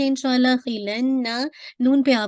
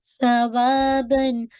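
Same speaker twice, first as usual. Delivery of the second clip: slow demo; much slower than usual. Shown as a demonstration. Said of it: "सा है".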